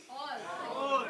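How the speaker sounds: ordinary delivery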